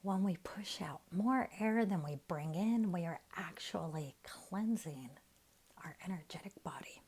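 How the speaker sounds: soft, unhurried meditation voice with long pauses; guided meditation. Real, not acted. Teacher guiding listeners to relax their face and jaw, soft meditation voice. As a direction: ease them gently into relaxation, leaving room to breathe.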